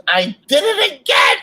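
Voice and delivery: hammed-up high crying shout; crying lady. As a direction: imitate a sobbing woman shrieking. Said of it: "Oh, she's crying".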